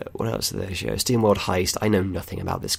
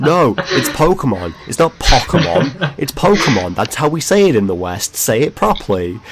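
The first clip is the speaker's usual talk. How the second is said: retold indignant outburst, louder and higher; exasperated correction; 5 semitones higher, 6 dB louder than usual. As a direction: correct him in loud mock outrage. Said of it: "it bugged the hell out of me".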